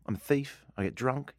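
usual speaking voice

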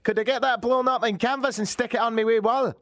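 high Scottish customer voice; put-on Scottish accent. Customer asking about a wall canvas, put-on Scottish accent. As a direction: ask in a broad, hammed-up Scottish accent. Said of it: "Scotland's good".

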